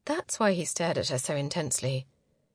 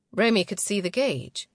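plain reading voice

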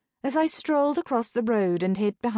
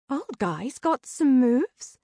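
slow, higher-pitched, impressed voice; pitched-up impressed sidekick; 5 semitones higher, slower than usual. A narrator's reading. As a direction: draw it out, impressed. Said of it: "Sabrina sounded impressed".

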